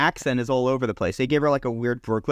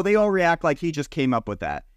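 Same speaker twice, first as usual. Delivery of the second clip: ordinary talk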